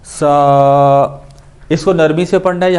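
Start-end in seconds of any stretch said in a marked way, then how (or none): 0.0-1.2 سا held long on one even note; whistling s, vowel of سا drawn out; stretched hard s. Shown as a demonstration. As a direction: stretch سا steadily. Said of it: "ایک الف کے برابر اس کو ہم نے کھینچنا ہے".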